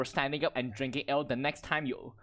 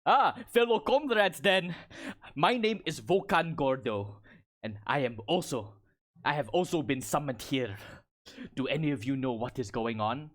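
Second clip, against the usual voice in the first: Goliath comrade. Acted. Goliath woman introducing herself to fellow comrades, in character. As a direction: introduce yourself as an accented Goliath warrior.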